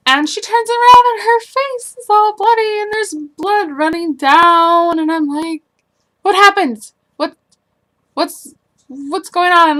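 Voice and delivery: high, loud alarmed retelling; panicked retelling. Retold or imitated. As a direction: relive the shock of seeing blood. Retelling finding mom bloody.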